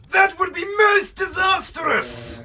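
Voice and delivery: high, loud, slow theatrical wail; mock dramatic. Fearing a zombie spawner, hammed-up theatrical wail. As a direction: ham up the disaster like a stage actor.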